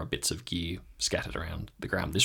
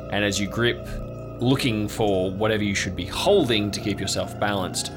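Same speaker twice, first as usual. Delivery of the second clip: game master narration. Acted. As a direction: draw out each phrase with ominous pauses. Game master sets an eerie scene.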